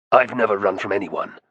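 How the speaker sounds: usual speaking voice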